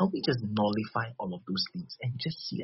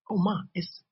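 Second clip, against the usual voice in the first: high, gushing hostess greeting; sweet welcome; 9 semitones higher, 3 dB louder than usual. Acted. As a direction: greet her like an eager usher. Acting welcome to a girl.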